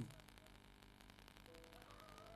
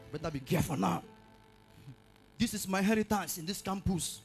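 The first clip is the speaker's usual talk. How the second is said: ordinary talk, nothing marked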